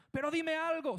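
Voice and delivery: desperate yelled plea. Acted. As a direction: shout up at a silent God. Pleading louder.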